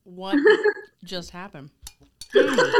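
sputtering after choking; coughing out words mid-choke; choking on a drink. Real, not acted. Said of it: "[name]'s choking over the, uh, cherries".

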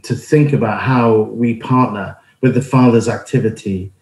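usual speaking voice

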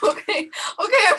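high, loud laughing okay. Real, not acted. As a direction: laugh through the words. Laughing after popcorn prank.

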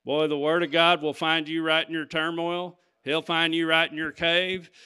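normal talking voice

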